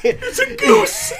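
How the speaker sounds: very high laughing movie line; quoting the movie while cracking up; laughing quote. Retold or imitated. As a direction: squeal the line through laughter.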